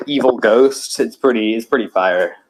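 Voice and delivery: usual speaking voice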